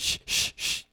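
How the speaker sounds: sharp, voiceless "shh"; urgent string of shushes; repeated shushing. Acted. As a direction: hush a noisy companion. Hushing companion while talking to rats.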